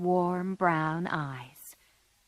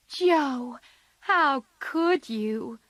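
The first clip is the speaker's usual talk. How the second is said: high, slow reproachful cry; 7 semitones higher, much slower than usual. A narrator's reading. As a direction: cry out in dismay at her sister. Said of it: "cried Meg".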